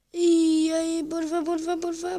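high, drawn-out plea; pleading. Real, not acted. Gamer begging the birds to hit, high pleading. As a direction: beg for luck in a high, sustained voice. Begs the game for luck.

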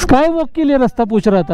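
high-pitched, agitated pleading with the officer; heated protest. Real, not acted. Arguing with a traffic officer. (ऊँची आवाज़ में झुंझलाकर अफ़सर से बहस)

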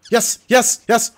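excited yes. Real, not acted. Gamer cheering the catch, a triumphant excited yes. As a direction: celebrate the catch.

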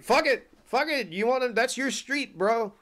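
high, loud, panicky backing-off; backing away from the hissing turtle, panicked; alarmed backing off. Retold or imitated. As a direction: retreat from the snapping turtle, voice way up. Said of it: "I was like".

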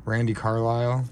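normal talking voice

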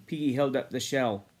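ordinary delivery

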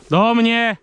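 sharp recall shout; recall call. Real, not acted. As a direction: call the dog back to you. Calling the dog back.

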